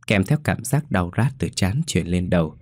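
usual speaking voice

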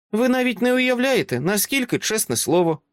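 put-on high child voice, calm and grown-up; a small girl who talks like an adult; composed little girl. Child asks Dresden to let them in, composed and high.

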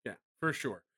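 soft, low sarcastic agreement; understated, offhand sarcasm. Real, not acted. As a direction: agree quietly with dry sarcasm. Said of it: "super sarcastic the way you said that".

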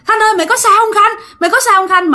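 urgent, high-pitched call to a friend; retold calling out. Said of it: "Thì bạn mới kêu rằng á là". (giả giọng gọi bạn thất thanh)

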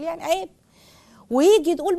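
ordinary delivery

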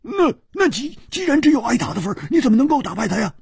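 high, very fast stammered question; baffled urgency. Acted. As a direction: rattle it out, utterly confounded. Baffled friend demands an explanation.

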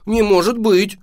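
put-on loud, high, know-it-all boy; authoritative. A narrator's reading. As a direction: declare it with total certainty. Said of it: "авторитетно заявил мальчик".

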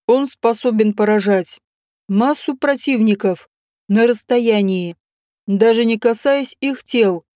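ordinary delivery, nothing marked

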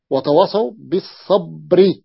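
slow recitation of وتواصوا بالصبر, the ba of بالصبر voweled with kasra and the ra stopped silent; Quranic recitation with naql. Shown as a demonstration. Teacher to students, repeating the slow pause on بالصبر with kasra moved to ba. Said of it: "عندما وقف بالسكون نقل هذه الكسرة إلى الباء".

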